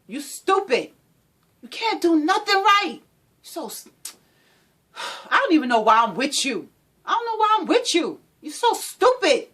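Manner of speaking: high, loud contemptuous insults; verbal abuse. Acted. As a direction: belittle her with scorn. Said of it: "The verbal abuse".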